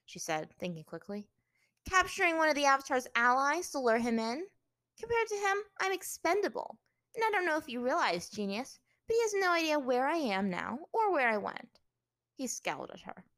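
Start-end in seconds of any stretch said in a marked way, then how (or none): 1.9-11.8 high, loud, defiant princess; defiant captive princess. A narrator's reading. Captive arguing against her captor's plan, defiant. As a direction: argue scornfully in a raised, high voice.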